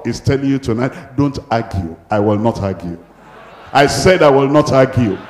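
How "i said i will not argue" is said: loud, emphatic preacher's vow; forceful, louder repetition for the congregation; insistent declaration. Shown as a demonstration. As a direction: insist on it with raised volume.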